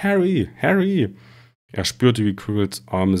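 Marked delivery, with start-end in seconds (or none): 0.0-1.1 called much higher and louder; calling out. A narrator's reading. Voices in Harry's head calling him, loud high calls. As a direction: call his name from far away. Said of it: "die riefen".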